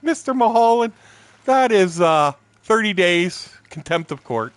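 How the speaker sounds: loud, high voice of a judge pronouncing sentence; hammed-up booming sentence from the bench; irate judge. Judge giving thirty days for contempt, loud mock outrage.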